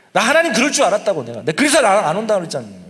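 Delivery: preacher voicing an angry Jonah; Jonah losing his temper. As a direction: play Jonah fuming at God. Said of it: "그래서 막 성질을 냅니다".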